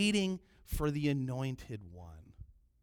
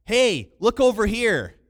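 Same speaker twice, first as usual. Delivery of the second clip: loud, high, slow hail; calling out; 6 semitones higher, 12 dB louder, slower than usual. Shown as a demonstration. Translating the overseas waiter call, loud hail. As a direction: shout to catch someone's attention. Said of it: "It literally meant".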